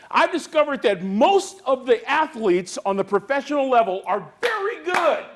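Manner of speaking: high, loud call to the crowd; revival-style motivational preaching; preaching at full force. Acted. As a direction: fire up the room like a preacher.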